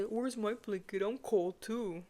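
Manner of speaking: soft, whiny imitation of a clingy friend; mocking a needy friend's whimper; whiny mock complaint. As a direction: mimic her pitiful whine, quiet and slow.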